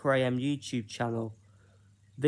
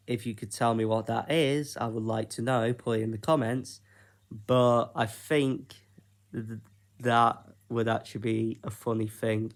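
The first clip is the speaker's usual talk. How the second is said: ordinary talk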